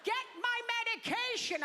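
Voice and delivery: very high mock elderly demand; demanding old audience member. Old audience member orders the comic around, mock demand. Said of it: "They tell me to do things for them".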